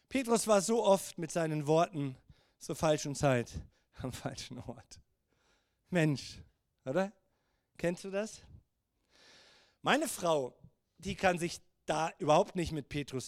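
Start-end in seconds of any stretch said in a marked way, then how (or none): none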